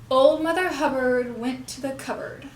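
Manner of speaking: put-on "Old", "Hubbard", "went", "cupboard" hit, the rest light; stress on old, Hubbard, went, cupboard. Shown as a demonstration. Teacher gives a nursery rhyme for puppet mouth practice, key words stressed. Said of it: "emphasizing the words old, Hubbard went, and cupboard".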